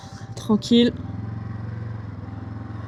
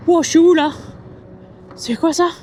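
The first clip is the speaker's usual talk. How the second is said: startled; 5 semitones higher than usual. Real, not acted. Lost on a dark road.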